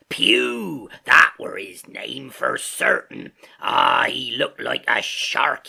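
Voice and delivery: loud, slow excited pirate. A narrator's reading. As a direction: pace and bluster with excitement. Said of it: "now quite excited".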